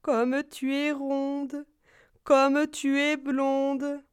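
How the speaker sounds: slow, sugary fox flattery; butter her up slowly; sly fox. Acted.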